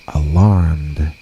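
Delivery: plain reading voice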